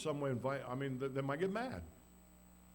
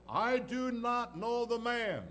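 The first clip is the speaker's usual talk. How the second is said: slow, loud, higher oath; vehement denial; 7 semitones higher, 7 dB louder, much slower than usual. Acted. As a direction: swear it with force. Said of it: "denied with an oath".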